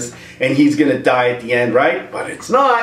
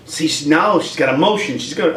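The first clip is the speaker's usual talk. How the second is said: ordinary talk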